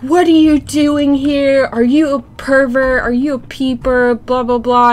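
high, loud mock accusing rant; affronted lady. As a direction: imitate a scandalized passerby firing off questions. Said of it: "This lady's like".

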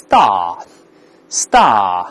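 British star, long open ah with no r; British pronunciation. Shown as a demonstration. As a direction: say star the British way, drawn out without r. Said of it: "英国人都是star".

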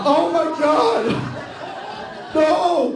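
high, loud drawn-out wail; horrified yell. Retold or imitated. Learning his cutout stands by the urinal, acted horrified yell. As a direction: yell it out long in mock horror.

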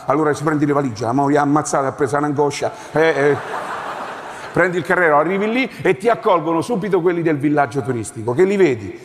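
ordinary talk, nothing marked

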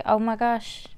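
usual speaking voice

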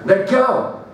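high sharp shout; hollering. A narrator's reading. Student hollers at teacher gripping his wrist, high shout. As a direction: yell to make her stop. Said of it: "I hollered".

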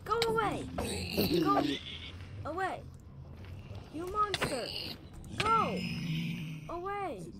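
high, panicked shooing yell; frightened high-pitched yelling; alarmed shouting. Real, not acted. Scared player shoos monster.

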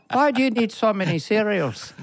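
put-on high, incredulous Irish accent; mock Irish bafflement; baffled Irish relatives. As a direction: question it as a bewildered Irish visitor. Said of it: "my wife's aunt and uncle".

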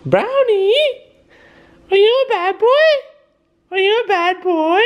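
very high, loud falsetto pet voice; sweet baby talk. Pretend. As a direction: coo it like praise, in falsetto. Reverse test, sweet-talking the dog.

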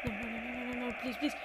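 tiny, low, very soft plea; hushed pleading. Real, not acted. Gamer pleading with the match while mom sleeps, hushed. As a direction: beg under your breath.

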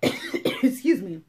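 slow, coughing through the words; choking on water, coughing it out. Real, not acted. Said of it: "That water went down the wrong pipe".

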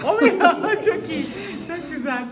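high, loud, laughing surprise; delighted surprise. Real, not acted. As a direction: react with amused disbelief. Surprised husband cooks.

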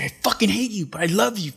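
high-pitched mimicked yell; retold yelling. Andy yelling at his friends while making a scene, imitated yell. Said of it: "like, yelling".

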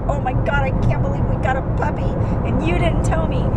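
high, fast gushing imitation of the daughter; mock excited daughter. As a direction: play the thrilled girl, rushing. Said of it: "flipping out like".

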